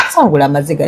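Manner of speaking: usual speaking voice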